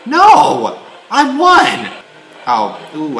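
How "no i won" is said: high, loud triumphant yell; raging gamer. Real, not acted. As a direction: shout the win, laughing. Winning the soccer game.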